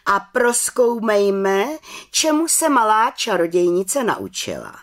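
high, loud proclamation to the council; calling out. A narrator's reading. Head witch starting the exam, proclaiming it. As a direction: announce it grandly, drawing out the first words. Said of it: "zvolala vrchní čarodějnice".

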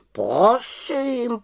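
high, whispered huffy; offended. A narrator's reading. Raven Abraxas takes offence, in a huffy whisper. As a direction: say it with wounded pride. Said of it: "urazil se Abraxas".

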